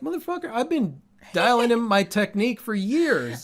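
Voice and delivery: indignant inner voice snapping back at a compliment; inner outburst. Retold or imitated. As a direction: let the irritation burst out as a private rant. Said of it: "In my mind, I'm like".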